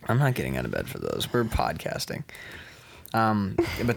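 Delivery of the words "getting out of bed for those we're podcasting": unvoiced hushed whisper. Real, not acted. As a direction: hush it to keep on air. Refusing to get up, whispering.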